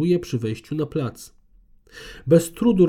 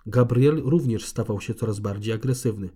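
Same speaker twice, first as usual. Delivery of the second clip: usual speaking voice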